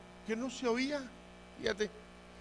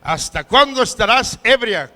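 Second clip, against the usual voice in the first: loud, raised-pitch reprimand; indignant, booming question; stern rebuke; 4 semitones higher, 10 dB louder than usual. Acted. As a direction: act out a priest rebuking a drunk. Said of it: "le dijo".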